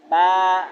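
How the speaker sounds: loud open-mouthed با; با with the jaw left hanging; demo letter ba. Shown as a demonstration. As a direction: say با and keep the mouth open at the end. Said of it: "منہ کھلا کا کھلا رہ جاتا ہے".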